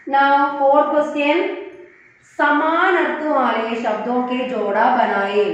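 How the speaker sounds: usual speaking voice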